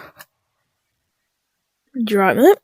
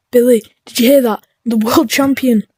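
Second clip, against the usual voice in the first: ordinary delivery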